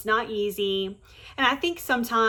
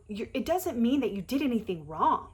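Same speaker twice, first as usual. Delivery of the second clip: usual speaking voice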